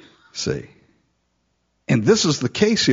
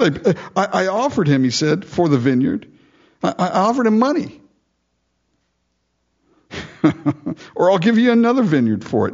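whining excuse; 7 semitones higher than usual. Retold or imitated. Pouting Ahab excusing himself.

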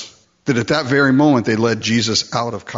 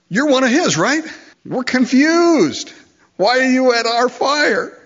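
mocking accusers; 3 dB louder than usual. Preacher voicing servants questioning Peter, high mocking taunt. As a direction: taunt him like a suspicious crowd.

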